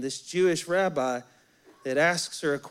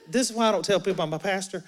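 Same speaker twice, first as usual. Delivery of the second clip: normal talking voice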